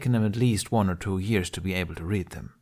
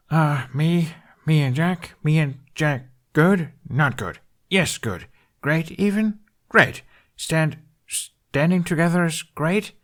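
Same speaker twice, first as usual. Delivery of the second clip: higher, halting voice, a hint of Hiccup; puzzled guessing; 7 semitones higher, 5 dB louder, slower than usual. Hiccup haltingly reading Sandy's signs, puzzled and guessing. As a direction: sound out each gesture unsure.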